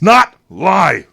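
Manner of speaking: put-on loud, high retort cutting the kid off; sharp objection. Government agent to teen, correcting her: loud, high objection. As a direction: snap back hard to correct her.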